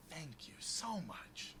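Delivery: soft, slow, drawn-out thanks; menacing thanks. Acted. Rogue thanks kobold before slicing its throat, softly sinister. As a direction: draw it out quietly, with menace.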